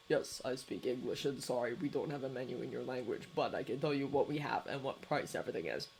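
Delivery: low, soft and level; Shota's low voice. A narrator's reading. Cafe owner greeting tourists, low and soft. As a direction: keep it low and quiet, a tired cafe owner.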